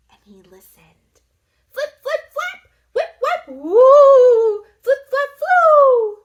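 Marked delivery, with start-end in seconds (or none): 1.7-6.2 much higher, loud whooshing flaps; flapping wing sounds. A narrator's reading. Narrator makes Drac's wings flap, high and loud. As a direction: flutter it out like bat wings. Bat wing noises read aloud.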